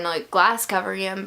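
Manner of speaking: normal talking voice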